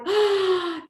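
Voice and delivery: high, loud gasp of recognition; a sharp shocked inhale; startled gasp. Retold or imitated. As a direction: gasp in sudden realisation. Recognising the ghost as herself.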